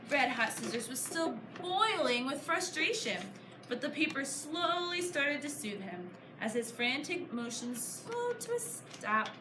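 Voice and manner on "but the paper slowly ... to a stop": plain reading voice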